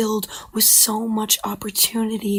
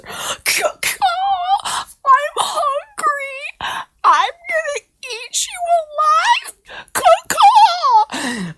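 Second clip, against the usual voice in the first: much higher, louder cawing vulture voice, drawn out; squawking vulture; 8 dB louder, much slower than usual. Acted. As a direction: caw like a hungry swooping vulture. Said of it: "It's a vulture".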